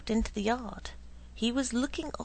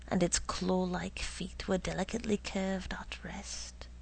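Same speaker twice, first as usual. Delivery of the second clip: plain reading voice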